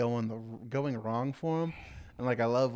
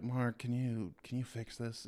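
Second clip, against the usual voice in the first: much softer, murmured plea; quiet inner-voice imitation; hushed voice imitation; 7 dB quieter than usual. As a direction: murmur it like a voice in his head.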